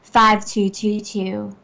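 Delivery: put-on phone-line voice, unhurried. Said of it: "going to do her phone voice".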